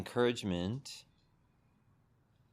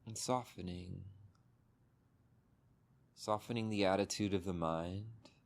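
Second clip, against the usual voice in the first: calm meditation guide, long pauses between phrases; unhurried, settling delivery, leaving space after each instruction; guided meditation. Real, not acted. Teacher guiding listeners to soften body and mind, guided meditation.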